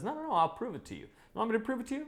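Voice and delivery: high, hurried bunny voice; quick-thinking bunny. Acted. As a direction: talk your way out, fast and high. Bunny talking fast to outwit lion.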